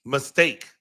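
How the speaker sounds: ordinary talk, nothing marked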